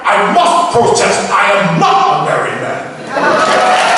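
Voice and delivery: slow, high and loud Klingon proclamation; battle cry. Shown as a demonstration. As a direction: declare it slowly like a proud warrior. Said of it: "I'll do the one that I didn't like it".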